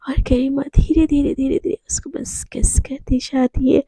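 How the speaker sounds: soft unvoiced story whisper; intimate whisper narration. A narrator's reading.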